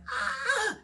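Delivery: a quick imitation of screaming as they lift her; mock pained scream. Retelling the scan lift for the listener, a mild mock scream of pain. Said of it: "I scream like".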